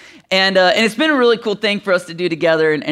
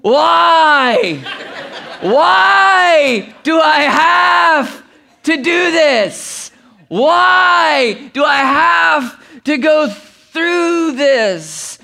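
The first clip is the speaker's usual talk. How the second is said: long, drawn-out mock wailing; hammed-up, stretched lament; complaining wail; 7 semitones higher, 4 dB louder, much slower than usual. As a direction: imitate a kid dramatically protesting her suffering. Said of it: "she starts complaining".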